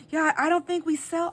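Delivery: high, sincere attempt to be helpful; so serious. Young clerk taking the edibles request seriously, earnest. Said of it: "and he was so serious".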